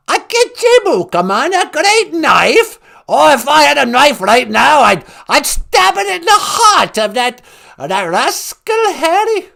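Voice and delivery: loud, high, scolding British grandmother; hammed-up royal indignation; Queen Mum. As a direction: scold like a cranky comic monarch. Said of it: "It's the Queen Mum".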